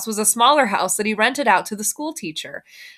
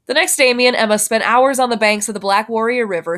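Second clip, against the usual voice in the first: plain reading voice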